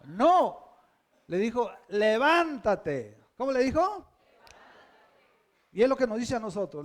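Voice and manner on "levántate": orden citada, fuerte y lenta; orden firme. Retold or imitated. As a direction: ordena con fuerza, alargando la palabra. Cita una orden del Señor. (mandato firme y pausado)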